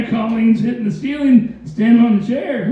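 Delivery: ordinary delivery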